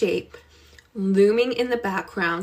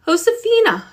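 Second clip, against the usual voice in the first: high, gentle call of a kind aunt; 10 semitones higher, 4 dB louder than usual. Acted. Aunt finds the girl alone in the dark, gentle call.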